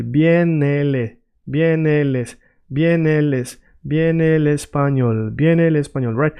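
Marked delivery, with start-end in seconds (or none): 0.0-5.3 slow, repeated practice chunks; careful drill of the tricky part; going slowly. Shown as a demonstration. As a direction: link the words slowly, piece by piece. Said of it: "I want you to go slowly".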